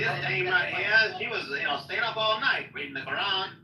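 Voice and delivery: old Hollywood accent, hammed up; Mid-Atlantic accent. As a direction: talk like a 1940s film narrator. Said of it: "Mid-Atlantic accent".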